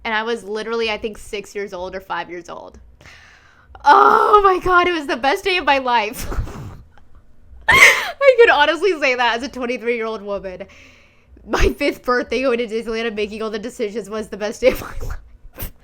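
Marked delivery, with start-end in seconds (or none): 3.8-15.7 loud teary cry that fades to a whisper; real crying, breaking on the memory; crying happy tears. Real, not acted. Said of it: "it makes me emotional".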